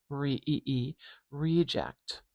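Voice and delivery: "reject" begins with a long, stretched ee; "re, e, e" said slowly before a full "reject" with a long first vowel; long pure vowel. Shown as a demonstration. Teacher building up the noun from its long first vowel. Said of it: "changing the schwa to a pure vowel".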